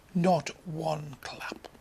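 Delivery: whispered deadpan; hushed. Real, not acted. Comic telling the crowd's silence, whispered.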